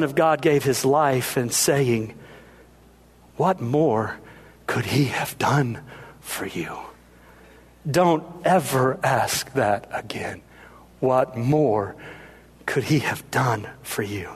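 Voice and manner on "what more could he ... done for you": low, soft, near-whispered reproof; hushed rebuke. Acted. Mary turning to the doubter at the cross, hushed rebuke. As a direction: let each phrase fall softly into a whisper.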